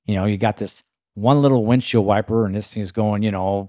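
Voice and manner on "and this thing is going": ordinary delivery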